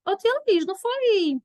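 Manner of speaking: mock student voice, high; imagined pupil. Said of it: "Aí vocês poderiam pensar".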